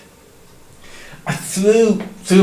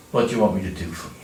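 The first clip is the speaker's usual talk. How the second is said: lower, softer and fast, kind; gentle voice; 8 semitones lower, 5 dB quieter, much faster than usual. Acted. Jesus gently asking the blind beggar, soft and low. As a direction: ask it gently as a caring healer. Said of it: "Such a gentle voice".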